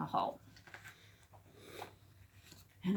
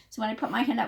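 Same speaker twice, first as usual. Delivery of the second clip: usual speaking voice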